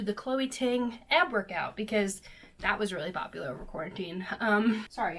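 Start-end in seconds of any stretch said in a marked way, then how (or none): none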